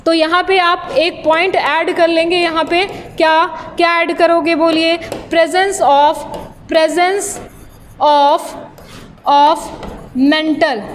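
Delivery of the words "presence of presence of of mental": spaced-out words for note-takers; slow dictation. Real, not acted. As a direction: dictate word by word, pausing after each. Dictating a point to students. (एक-एक शब्द रुक कर लिखवाते हुए)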